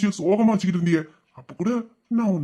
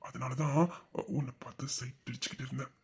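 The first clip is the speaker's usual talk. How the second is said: whisper; 6 semitones lower, 14 dB quieter than usual. Acted. Husband confesses ogling his wife.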